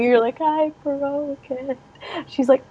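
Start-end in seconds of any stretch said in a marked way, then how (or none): 0.4-1.8 slow, high, soft wail; imitating a child's pitiful confession; mock crying. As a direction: draw it out like a sobbing kid. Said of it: "like, you're crying".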